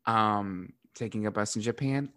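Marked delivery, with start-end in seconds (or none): none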